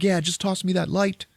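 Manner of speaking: high, strained call from below. Retold or imitated. Fallen man asking for a flashlight, calling up. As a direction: imitate someone calling up out of a hole.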